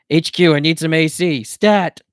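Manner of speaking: loud, high mock-urgent radio call; pantomimed radio call. A narrator's reading. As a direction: shout into a pretend radio. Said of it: "Dakota pantomimed".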